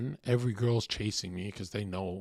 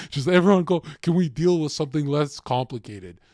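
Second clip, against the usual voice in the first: exasperated; 5 semitones higher, 11 dB louder than usual. Pretend. Quoting exasperated police.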